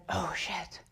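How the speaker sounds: soft unvoiced whisper; whispered dismay. Assistant seeing them arrive, whispered dismay. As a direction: breathe it under your breath. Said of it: "he's like".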